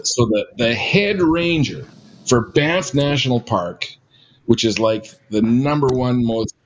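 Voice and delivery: ordinary delivery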